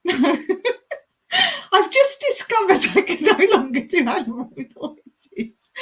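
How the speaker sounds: high, giggling through the words; real laughing fit, slowed and squeaky; helpless laughter. Real, not acted. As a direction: talk while cracking up. Cracking up at failed roar.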